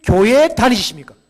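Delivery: loud question. Shown as a demonstration. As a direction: boom the question out. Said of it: "전도의 문을 열는 하나의 질문법이".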